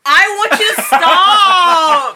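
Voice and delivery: loud, slow, high laughing wail; laughing whine. Acted. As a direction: wail it, laughing, playing hurt. Mock hurt after teasing comment.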